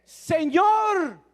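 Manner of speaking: Peter's desperate shout for help; desperate cry. Acted. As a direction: cry out like a sinking man. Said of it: "gritando desesperadamente".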